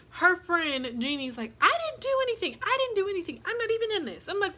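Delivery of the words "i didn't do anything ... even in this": very high mock protest; protesting innocence. Jeannie denying any part in it, high-pitched mock protest. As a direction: squeal the denial in an imitated high voice.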